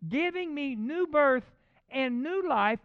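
high, loud, stretched-out phrases; rising preacher's proclamation; emphatic scripture preaching. Real, not acted. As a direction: proclaim the verse slowly, phrase by phrase. Reads scripture at full preaching force.

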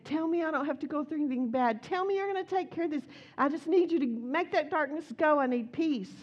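hammed-up anxious plea for peace; pleading prayer. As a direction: beg God to make it stop, half acting it out. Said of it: "I was like".

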